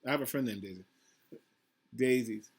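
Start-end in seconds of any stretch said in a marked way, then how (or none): none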